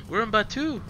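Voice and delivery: excited. Real, not acted. Thrilled to arrive in park.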